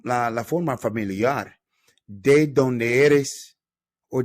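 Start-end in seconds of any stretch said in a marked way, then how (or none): 2.1-3.6 "¿De dónde eres?" slow, rising and falling; question intonation. Shown as a demonstration. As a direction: model the question tune. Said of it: "Little intonation right there for you people".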